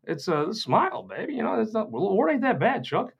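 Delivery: high, teasing brush-off; mock cheerful. Ribbing a crotchety old man, high and teasing. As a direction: tell the grump to lighten up, mockingly. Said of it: "I'm like".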